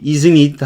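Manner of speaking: "Isn't it?" said with a falling tune; falling tag question demo. Shown as a demonstration. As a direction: let "Isn't it?" drop down. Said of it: "하고 내려가는 거예요".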